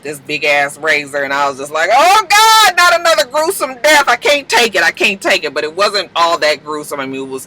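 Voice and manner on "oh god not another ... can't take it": high, loud wail settling down; horrified dread. Retold or imitated. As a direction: wail in hammed-up horror, then ease off. Reacts to coming gruesome death.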